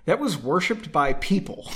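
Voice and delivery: ordinary delivery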